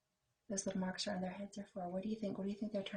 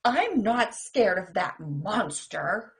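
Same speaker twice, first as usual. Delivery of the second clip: slow, loud insistence; mock bravado; 15 dB louder, much slower than usual. Sam insisting to the monster he isn't scared. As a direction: puff up bravely.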